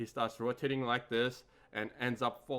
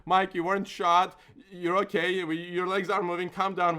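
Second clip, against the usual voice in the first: loud, raised, urgent retold reassurance; calming him down; 7 semitones higher, 8 dB louder than usual. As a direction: talk a panicking friend down over the commotion. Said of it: "And we calm him down".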